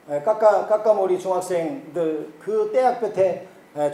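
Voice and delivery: ordinary talk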